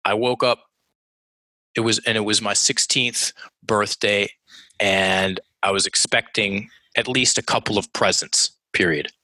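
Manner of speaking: measured, long breathing pauses at the periods; subdued, deliberate recounting; slowed-down storytelling. Shown as a demonstration. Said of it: "I'll give you an example".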